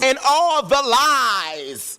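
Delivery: dramatic drawl. Acted. Drawn-out dramatic phrase.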